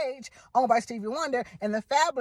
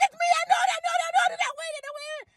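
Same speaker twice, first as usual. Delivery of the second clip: very high, shouting I know it then fading off; a winner who can't believe it; squealing excitement; much faster than usual. Retold or imitated. Caller shrieking on the radio that she knows it, very high. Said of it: "I said".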